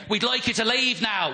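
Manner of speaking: mock Irish voice; imitated Irish accent. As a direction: mimic a polite request in an Irish accent. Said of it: "the Irish were saying".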